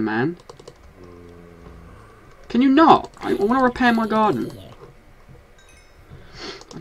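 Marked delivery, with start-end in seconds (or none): 2.5-4.5 much higher and louder outrage; exasperated yell. Real, not acted. Player shouting at creeper that blew up his garden, exasperated. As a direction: cry out at the ruined garden.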